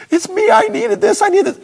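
high, loud imitation of crying; retold tearful outburst. Preacher reliving breaking down over the gift, retold tearful outburst. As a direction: blurt it out, imitating overwhelmed tears. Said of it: "I broke down in tears".

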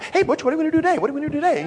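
high-pitched, eager cartoon sidekick dog; little rat dog. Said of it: "the little rat dog".

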